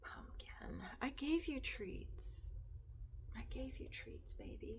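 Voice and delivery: whispering to the cat; hushed whispered pet talk. Real, not acted. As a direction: keep it a whisper so you don't break the mood. Whispering to cats on camera.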